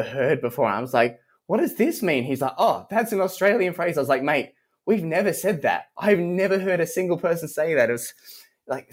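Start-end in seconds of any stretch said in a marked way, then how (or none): 1.5-2.2 higher-pitched mock bafflement; puzzled, high-pitched retelling; retold baffled question. Retold puzzled challenge to student. 2.6-4.0 lightly voiced student, pitched higher; imitating an earnest student; retold student reply. 4.2-7.9 higher-pitched mild protest; incredulous mock objection; retold exasperation. Retold exasperated reply to student.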